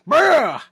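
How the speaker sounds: high, loud "bah" holler; imitated shout. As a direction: bellow a wordless mock call. Re-enacts the shouted call again.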